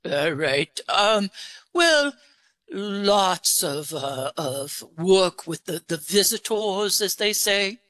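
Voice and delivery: put-on English accent voice, a bit deep. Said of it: "you've got a very distinctive voice. It's, uh, it's deep-pitched".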